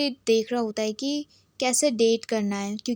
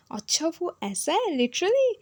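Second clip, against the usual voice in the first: higher, slow, drawn-out disbelief; hammed-up amazed gasp of a question; mock surprise; 6 semitones higher, slower than usual. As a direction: stretch out the shocked question. Friend reacts to shocking news.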